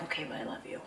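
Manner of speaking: voiceless tender whisper. Retold or imitated. Kaylin whispering to Ashley in the back room, tender whisper. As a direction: breathe it softly, insisting.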